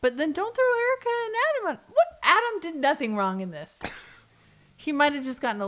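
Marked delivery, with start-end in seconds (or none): 0.0-3.8 high, loud, fast exasperated protest; exasperated outburst. Real, not acted. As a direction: protest in disbelief. Exasperated at the show.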